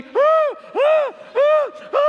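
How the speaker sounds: loud, very high wailing cries; imitated wounded-animal wail. Preacher imitating the flailing boy held down, wounded-animal wail. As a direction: mimic a hurt animal's repeated yelps. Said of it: "crying like a wounded animal".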